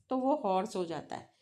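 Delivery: ordinary talk, nothing marked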